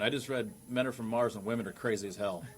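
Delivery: normal talking voice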